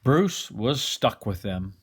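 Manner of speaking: ordinary delivery, nothing marked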